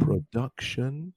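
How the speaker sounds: "Production" slowed, each syllable clear, ending on a rise; slow pro-DUC-tion; slow syllable demo. Shown as a demonstration. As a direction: model "Production" slowly for a learner. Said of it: "three syllables here we have".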